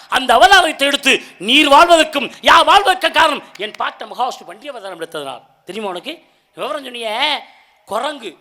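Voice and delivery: ordinary talk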